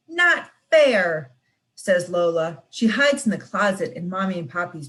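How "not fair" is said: high, loud whiny complaint; childish protest. Acted. Shut-out little girl protesting to her sister. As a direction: stamp your foot like a small kid.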